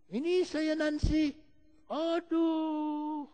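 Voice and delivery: high, drawn-out wail of distress; imitating a crying woman. Desperate friend answers the phone, mock tearful. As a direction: act out a tearful caller. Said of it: "Dia nangis".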